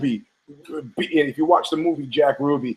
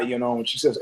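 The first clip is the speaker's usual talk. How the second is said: usual speaking voice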